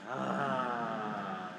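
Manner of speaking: a soft, audible breath-out sigh; guided sigh. Shown as a demonstration. Leading the room through breathing, soft audible sigh. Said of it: "out with a sigh".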